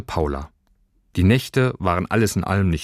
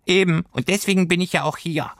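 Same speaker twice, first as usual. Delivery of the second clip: high, chirpy furry creature voice; cheerful little creature; 11 semitones higher than usual. Acted. Furry creature cheerfully explaining to Lilli, high voiced. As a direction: play the good-humoured little pelt beast. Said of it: "immer noch gut gelaunt".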